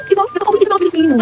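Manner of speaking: ordinary talk, nothing marked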